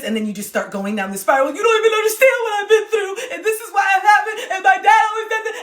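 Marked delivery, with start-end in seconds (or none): 1.5-5.6 high, loud, tearful whine, fast at the end; whiny crying meltdown. Retold or imitated. Mocking a partner spiralling into a tearful whine. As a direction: act out a sobbing tantrum.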